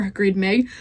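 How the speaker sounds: plain reading voice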